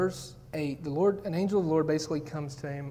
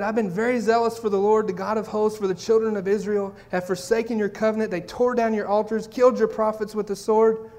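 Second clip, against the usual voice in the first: louder, protesting to God; indignant complaint; 3 semitones higher, 7 dB louder than usual. Acted. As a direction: plead your case with heat. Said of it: "listen to the response".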